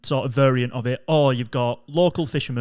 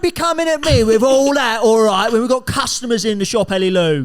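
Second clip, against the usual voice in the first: scolding dad; 7 semitones higher, 4 dB louder than usual. Acted. Dad scolding daughter in act-out.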